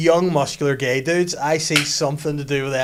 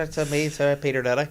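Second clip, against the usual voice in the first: mock Italian accent; 2 semitones higher than usual. Mimics a catchphrase.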